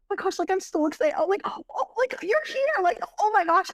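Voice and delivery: high, fast gushing friend; mock-excited high gush. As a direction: squeal like you haven't seen your best friend in forever. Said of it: "she's like".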